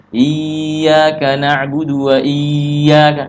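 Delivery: "iyyaka" with the doubled y held long and hummed; slow chant overholding "iyyaka"; wrongly droned shadda. Shown as a demonstration. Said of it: "jangan terlalu lama didengungkan".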